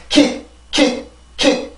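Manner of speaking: "킥" cut very short, the Korean way; Korean-accented kick. Shown as a demonstration. Teacher warning learners off it, Korean-style pronunciation. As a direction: say English kick as a Korean syllable, abruptly stopped. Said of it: "한국어처럼 킥 이렇게 발음을 하지 말고".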